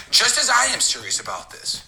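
insistent push. Retold or imitated. Pressing the partner hard.

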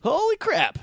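high-pitched excited holy crap; excited exclamation. Acted. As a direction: squeal it with glee. Echoing excited reaction.

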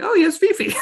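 squeaky laughing realization; giggly high mock voice. Podcaster mocking her own realization, laughing high. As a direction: blurt it high through a laugh.

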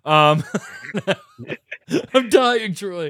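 high, strained, laughing through a choke; choking on a drink. Real, not acted. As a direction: squeeze the words out while choking. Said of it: "I'm choking on iced tea".

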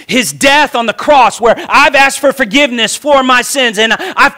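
high, loud, rising sermon cry, words almost sung; preaching at full force. Acted. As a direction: build the fervour line by line, preacher pouring it out.

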